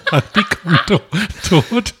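lachend, deutlich höher. Real, not acted. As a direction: prustend vor Lachen. Lacht über den eigenen Witz. (lacht über den eigenen Witz)

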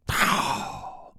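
sudden unvoiced-sounding burst of breath; imitated turkey gobble. Hunter retelling how the tom hammered back, mimicked gobble burst. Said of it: "he hammered. I mean, just".

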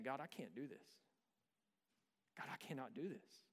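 soft, worn-out plea to God; quiet desperate prayer. Retold or imitated. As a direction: murmur it like a tired father at 3am. Retold desperate prayer at night.

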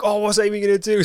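high, excited quote; gleeful watching; excited gasp. Retold or imitated. Friends watching on CCTV.

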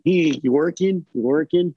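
lightly voiced Chip hustling; Chip's pushy line. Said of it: "Chip's line of".

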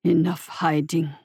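put-on low, slow accented whisper. A narrator's reading. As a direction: breathe it out slowly, menacing. Creature continues, whispered.

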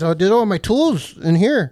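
high, slow and loud mock voice; mock dumb stranger. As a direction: ham up the clueless thief. Mocking stranger's excuse.

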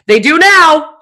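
high, loud mock command; forceful retort. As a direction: imitate a bossy therapist overruling. Therapist overrules her objection.

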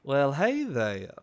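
a hint of a slow drawl; slow drawling hello. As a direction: stretch the greeting out lazily. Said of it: "you just hear a".

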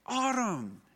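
high call of a name across a playground; imitating a little boy yelling a friend's name.

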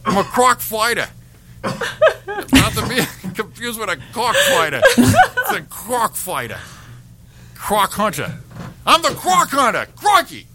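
laughing, high Aussie voice; Australian accent. Acted. Croc hunter corrects himself, laughing in an Australian accent. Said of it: "in Australian".

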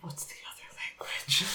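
whispering. Retold or imitated. Retelling own confused thought.

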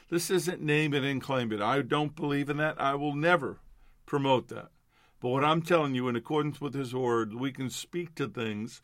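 ordinary talk

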